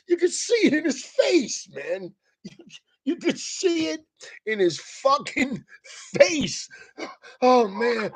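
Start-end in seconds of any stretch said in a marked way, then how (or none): none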